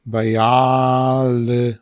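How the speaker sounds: "Bial-" drawn out long with a doubled j; stretched syllable demo. Shown as a demonstration. Teacher showing where the double j goes, drawn-out syllable. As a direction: hold the stressed "ja" in "Bial-". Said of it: "podwójne j".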